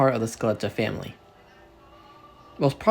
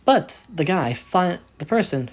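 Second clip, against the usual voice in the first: plain reading voice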